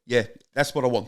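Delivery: South African car buyer; put-on South African accent. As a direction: answer as a South African customer. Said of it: "do a South African".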